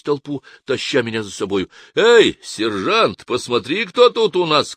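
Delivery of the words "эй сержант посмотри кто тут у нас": loud, high, slow call across the hall. Acted. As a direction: shout to the sergeant over the crowd. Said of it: "крикнул Град".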